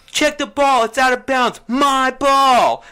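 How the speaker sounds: high, loud mocking imitation of an opponent; exaggerated high holler, drawn up loud; mimicking Bradley's voice. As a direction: yell it out in a high-pitched caricature. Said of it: "that's how he sounded".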